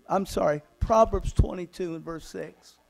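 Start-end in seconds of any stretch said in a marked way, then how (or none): none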